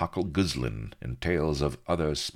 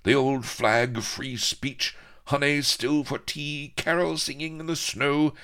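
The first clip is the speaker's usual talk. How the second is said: very high, impassioned plea; indignant patriotic hero; 14 semitones higher, 5 dB louder than usual. A narrator's reading. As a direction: climb into high-pitched patriotic fervour.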